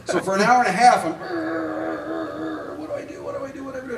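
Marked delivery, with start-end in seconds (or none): 1.1-3.9 high, quieter frantic what do I do; squeaky mock-anxious muttering; mock panic. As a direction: flail about, hammed-up panic. Panicked self-parody, looking for someone.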